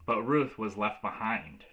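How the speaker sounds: usual speaking voice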